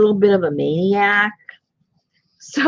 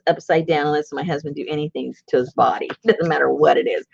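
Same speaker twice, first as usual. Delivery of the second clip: ordinary talk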